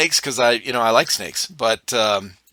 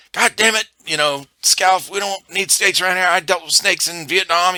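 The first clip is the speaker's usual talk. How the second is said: higher-pitched imitation of a rattled gunny; mock complaint, pitched up; freaked-out gunny; 7 semitones higher than usual. Gunny scolds marine over a snake, freaked out. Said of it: "it freaked him out".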